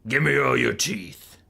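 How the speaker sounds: slow, menacing boss demand; big boss Azhag. Acted. Azhag robbing the goblin tribe, slow boss voice. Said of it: "Azhag comes over and he says".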